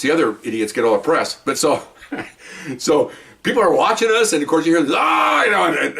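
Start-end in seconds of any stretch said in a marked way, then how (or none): 5.0-5.4 high mock scream. Imitating a scream heard.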